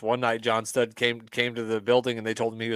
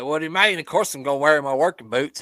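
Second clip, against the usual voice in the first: a light mock Southern accent, a bit louder; 4 semitones higher, 6 dB louder than usual. Voicing the wrestler with a light mock Southern accent. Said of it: "give people Southern accents".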